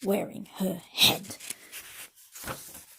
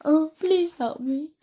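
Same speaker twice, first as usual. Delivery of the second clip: high, loud, slow tearful plea; a girl pleading in mock sobs; sobbing plea; 8 semitones higher, 6 dB louder than usual. A narrator's reading. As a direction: beg through the tears. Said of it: "sobbed the girl".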